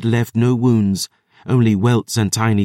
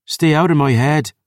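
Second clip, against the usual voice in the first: a raised roar, pitched up; 6 semitones higher than usual. A narrator's reading. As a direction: roar it back at the witch. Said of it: "the flesh witch roared".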